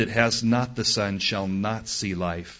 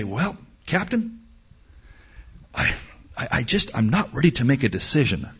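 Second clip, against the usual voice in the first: halting, stammering reluctance; hesitant; 3 dB quieter, faster than usual. Acted. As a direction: stall with broken false starts, acting the undecided passenger. Voicing undecided passenger answering captain.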